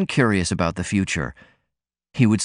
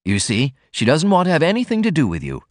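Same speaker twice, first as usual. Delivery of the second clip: higher blurted interjection; 7 semitones higher, faster than usual. A narrator's reading. Brother cuts in to end the talk, blurted higher. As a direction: blurt it out over them. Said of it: "Norman blurted out".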